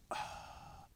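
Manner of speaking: soft, voiceless exhale of release; breathy sigh. Retold or imitated. Dad hearing someone finally ask, sighing out. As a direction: let all the breath go in a sigh. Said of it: "And the guy just went".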